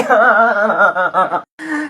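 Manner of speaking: put-on tantrum wail; bawling child. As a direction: bawl like a thwarted kid. (paiyak na ngawa)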